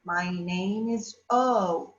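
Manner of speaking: lightly voiced letter O saying its name; vowel character. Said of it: "The vowel will say".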